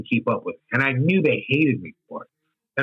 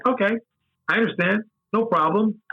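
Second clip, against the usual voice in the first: higher, breezy mock acceptance; mock agreeable; 8 semitones higher than usual. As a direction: brush it off sweetly, no problem. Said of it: "And I was like".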